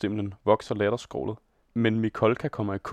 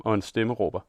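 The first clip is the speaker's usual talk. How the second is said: usual speaking voice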